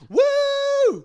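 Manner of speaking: high, loud whoop; mock cheer. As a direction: whoop like a party crowd. Cheering the friend's story.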